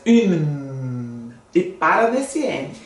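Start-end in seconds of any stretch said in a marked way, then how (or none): 0.0-1.3 Une said lower, ending firmly on the N; stopping on the n. Shown as a demonstration. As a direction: stop hard on the final N of Une. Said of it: "Dois N mesmo".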